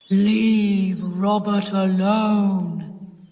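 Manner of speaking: slow, whispered, eerie ghost voice; deep ghost voice. Acted. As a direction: hiss it low like a ghost. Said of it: "she said in a deep voice".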